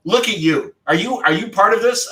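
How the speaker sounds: high, delighted "look at you"; delighted surprise. Retold or imitated. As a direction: sound thrilled to see him. Mrs Sayers voiced in story.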